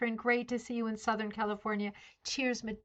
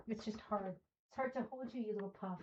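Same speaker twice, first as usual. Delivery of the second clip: hushed, fond murmur to the puppy; 10 dB quieter than usual. Real, not acted. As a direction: coo at the little dog while setting it down. Talking softly to the dog.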